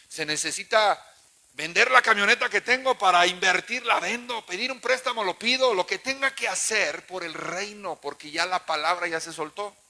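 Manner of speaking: loud rising preacher's vow; loud sermon vow; preaching at full force. Real, not acted. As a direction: build to a loud declaration. Preaching climax.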